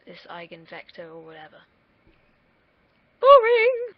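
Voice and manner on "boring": much higher, loud mock shout of boring; mock yell. Vlogger mocking his own maths talk, loud mock yell. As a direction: bellow Boring! high and loud.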